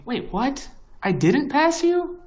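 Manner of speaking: mock disbelief. To the co-host who beat him, mock-shocked high squeal. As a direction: act shocked you got passed. Mock outrage at league rankings.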